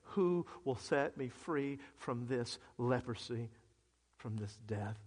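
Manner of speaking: soft, low, slow plea; hushed, drained question, fading at the end; numb and tired. Acted. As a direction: sink quietly into weary numbness. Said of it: "so numb and so tired of being numb".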